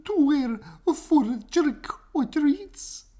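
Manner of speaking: high, slow sweet mother voice; lightly voiced doting mother; mother's voice. A narrator's reading. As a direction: mom offering her son a costume. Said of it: "said Oscar's mother".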